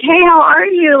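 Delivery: sudden high, over-friendly hello, retold; awkwardly perky greeting. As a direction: cover the embarrassment with a chirpy hello. Flustered greeting to co-star after rant.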